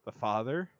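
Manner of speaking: usual speaking voice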